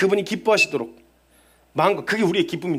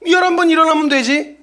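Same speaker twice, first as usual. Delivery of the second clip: louder and higher, triumphant preaching; rousing proclamation; 9 semitones higher, 7 dB louder than usual. Acted. Preacher urging a fallen believer to rise, in a loud rousing voice. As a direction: lift the congregation with a loud promise.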